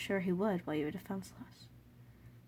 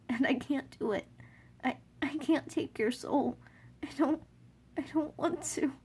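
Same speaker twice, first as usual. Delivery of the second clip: tearful pleading; 6 semitones higher, 4 dB louder than usual. Acted. Pleading with Asgore in tears.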